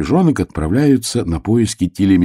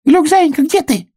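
high, loud anxious cry; hedgehog's cry; 5 dB louder than usual. A narrator's reading. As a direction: cry out for a lost friend. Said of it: "вскрикнул ёжик".

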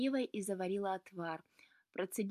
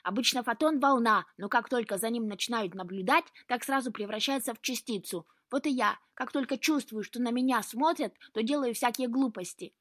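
loud, higher-pitched insistent explanation; self-justifying cat; 4 semitones higher, 6 dB louder than usual. A narrator's reading. Cat explaining photon syndrome to Luka, self-justifying. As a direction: argue your excuse with energy.

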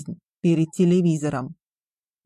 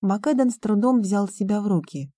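plain reading voice

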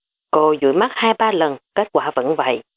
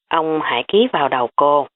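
plain reading voice

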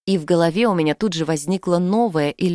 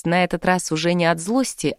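usual speaking voice

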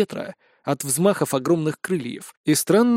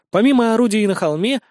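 ordinary delivery

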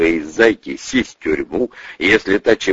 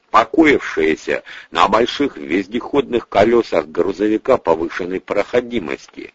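ordinary delivery

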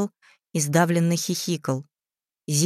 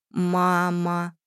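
quiet creepy doll voice. A narrator's reading. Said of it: "тихо, но отчётливо сказали".

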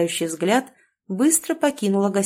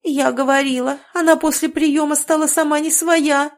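moaning lament; 7 semitones higher, 4 dB louder than usual. Acted. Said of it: "простонала мадам Жанет".

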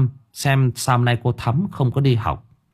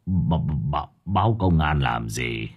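startled stammer; 8 semitones lower, 4 dB quieter than usual. A narrator's reading. Old Thủy stunned when the teacher mentions police, stammering. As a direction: lower and softer, tripping on the first word. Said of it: "Ông Thủy sửng sốt".

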